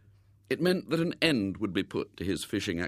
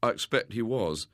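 ordinary delivery

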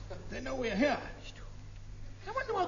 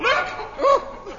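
high, loud cry of alarm; alarmed call; 10 semitones higher, 5 dB louder than usual. Acted. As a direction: shout a sudden warning. Alarmed sighting of German patrol.

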